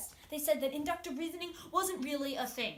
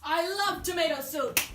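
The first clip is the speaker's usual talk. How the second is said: excited; 5 semitones higher, 8 dB louder than usual. Acted.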